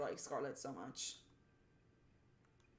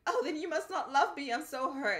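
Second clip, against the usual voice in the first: mock hurt; 7 semitones higher, 5 dB louder than usual. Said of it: "I was like".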